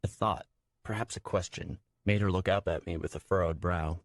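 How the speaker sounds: plain reading voice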